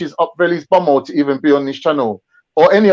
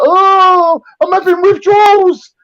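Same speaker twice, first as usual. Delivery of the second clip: much higher, slow, whiny withdrawal moan; mocking junkie voice; 12 semitones higher, 3 dB louder, slower than usual. Mocking a banned streamer viewer as an addict, high whiny moan. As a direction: play a kicked-out viewer as a desperate addict. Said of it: "like he's taking drugs away from you".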